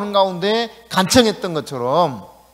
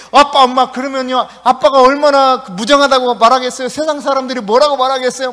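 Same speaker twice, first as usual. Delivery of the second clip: put-on a higher, louder child begging the parents; pleading child; 5 semitones higher, 6 dB louder than usual. Obedient son begs his parents not to punish, pleading. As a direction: plead with mom and dad so they change their minds. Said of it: "막 하면서 이야기하는".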